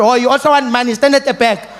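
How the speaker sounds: high, loud dismissive scolding; teacher losing it. Acted. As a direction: order them off like a fed-up teacher. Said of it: "Teacher lost her mind".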